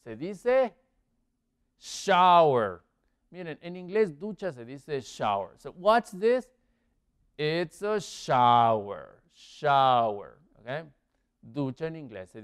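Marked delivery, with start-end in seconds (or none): 1.8-2.8 SHOWER loud, with a falling end; emphatic model word. Shown as a demonstration. Teacher gives the answer word. 7.4-10.4 lower, drawn-out SHOWER, then repeated firmly; slow model answer. Shown as a demonstration.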